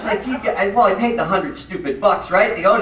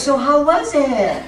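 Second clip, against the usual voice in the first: slow, higher sugary wife voice; sweet wife; 8 semitones higher, 3 dB louder, slower than usual. Retold or imitated. Wife sweetly asking her husband about the retreat. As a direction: sound extra nice to make up.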